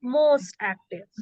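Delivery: "most active" slowly, MOST leaned on; slow, stressing MOST. Shown as a demonstration. Teacher giving the superlative, slow with MOST stressed. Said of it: "So you're going to say".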